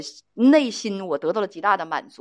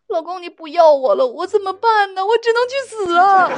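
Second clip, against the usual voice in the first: higher, louder, building to a mock wail; teary pleading; 9 semitones higher, 5 dB louder than usual. Girlfriend begging boyfriend not to break up, hammed-up tearful wail. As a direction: imitate a girlfriend pleading with tears in her voice. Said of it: "他眼泪汪汪的对我说".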